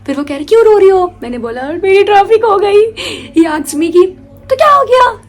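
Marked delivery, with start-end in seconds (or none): 0.4-1.1 concerned adult. Retold or imitated. Guest asks crying girl. 1.6-2.9 high, slow crying complaint; teary wail. Retold or imitated. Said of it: "मैं रोए जा रही हूँ". 4.5-5.2 dismissive adult. Retold or imitated. Guest brushing off her loss.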